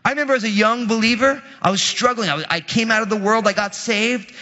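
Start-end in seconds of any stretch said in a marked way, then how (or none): none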